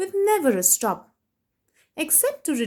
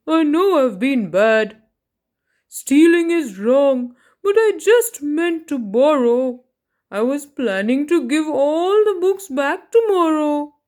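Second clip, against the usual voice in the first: a touch of a sheepish thief voice, louder; sorry thief; 3 semitones higher, 5 dB louder than usual. Acted. Book thief apologising to the children, sheepish. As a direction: plead your excuse. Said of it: "The book thief looked sad".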